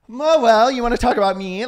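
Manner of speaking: high, loud mocking of a self-centred guest; mock diva. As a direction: play the attention-seeker, big and nasal-free. Mock-voicing a pushy interview guest.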